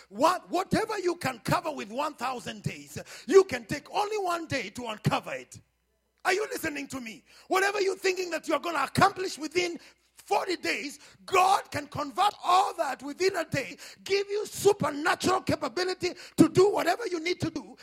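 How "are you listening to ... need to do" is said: high, loud preaching build; fiery preaching. Acted. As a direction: preach it up to a shout. Preaching at full force.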